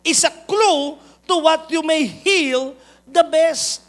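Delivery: slow, loud sermon climax; preaching at full force. Acted. Pastor declaring his main point to the church, loud and slow.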